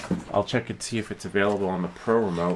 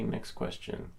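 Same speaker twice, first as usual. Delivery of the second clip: ordinary talk, nothing marked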